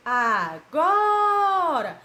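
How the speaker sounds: "agora" called out slowly, GO held long and high; loud chanted "agora" with a stretched GO; drawn-out stressed syllable. Shown as a demonstration. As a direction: sing "agora", lingering loudly on "go". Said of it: "a tia demora mais pronunciar".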